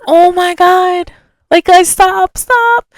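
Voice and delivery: high, pleading stop-the-fight voice; mock panicked plea. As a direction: beg them to stop, rising. Telling friends to stop fight.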